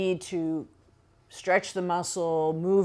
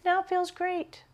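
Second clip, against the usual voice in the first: relieved delight. Real, not acted. Relief after the hard stretch.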